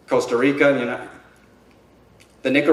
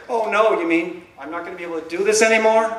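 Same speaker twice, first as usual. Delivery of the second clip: high-pitched mock alarm; mock dismay; 7 semitones higher than usual. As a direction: play at being dismayed, pitched up. Acts out his mock dismayed reply.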